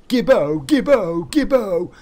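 loud, high mock chant; imitated shout. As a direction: call it out like a rowdy mate. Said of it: "That's what people shout".

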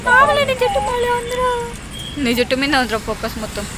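high, loud sing-song coaxing. Real, not acted. Talking to the child. (పిల్లతో ముద్దుగా మాట్లాడటం)

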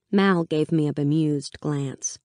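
usual speaking voice

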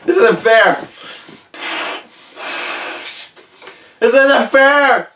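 loud, high-pitched whine of dread; panicked protest. Acted. Balloon blower protests as it nears bursting, loud whining panic. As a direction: wail it out, drawn out and helpless.

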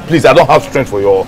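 exasperated plea. Acted. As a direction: beg her to leave it. Pleading with ex to leave.

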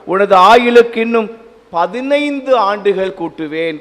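ordinary talk

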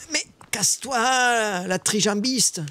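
high, drawn-out squeal of annoyance; exasperated whine. Real, not acted. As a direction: stretch it out slowly, high and exasperated. Exasperated at limping prey.

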